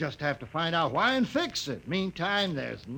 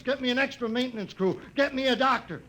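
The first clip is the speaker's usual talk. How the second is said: frantic orders; 7 semitones higher than usual. Acted. Boss barking panicked orders.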